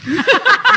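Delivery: loud, high laugh; burst of laughter. Real, not acted. Guest laughing about her interviews, loud high laugh. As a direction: break into a high laugh at your own remark.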